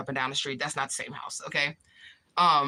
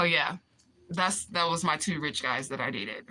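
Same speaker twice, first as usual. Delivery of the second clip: normal talking voice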